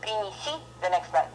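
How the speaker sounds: ordinary delivery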